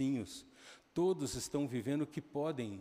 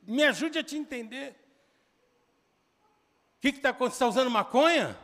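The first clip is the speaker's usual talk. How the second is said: acted pleading shout; 10 semitones higher, 9 dB louder, faster than usual. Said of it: "eu tava num grito de".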